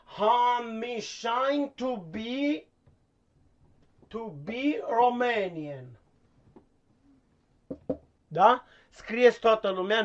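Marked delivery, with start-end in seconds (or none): 0.1-5.9 slow dictation. Shown as a demonstration. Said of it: "nu vă grăbiți".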